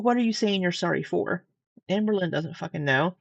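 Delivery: ordinary talk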